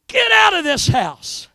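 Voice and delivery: high, loud order with falling end; forceful retold command. Preacher voicing Jesus clearing out the mourners, forceful command. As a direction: throw the command out hard.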